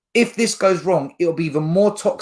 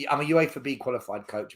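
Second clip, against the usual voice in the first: ordinary talk, nothing marked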